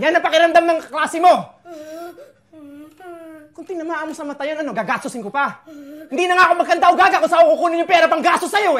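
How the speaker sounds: put-on unload all his frustration on the kid; exhausted father shouting; furious rant. Father raging about costs and blame.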